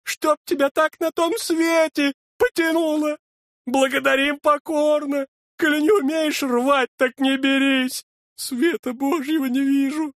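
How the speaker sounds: high, loud outraged scolding; furious complaint; angry sexton. A narrator's reading. Дьячок ругает фельдшера. (возмущённо, высоким голосом)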